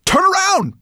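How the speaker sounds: high, urgent warning shout. A narrator's reading. Ben to Firedrake as the huge bird approaches, urgent shout. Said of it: "Ben shouted to Firedrake".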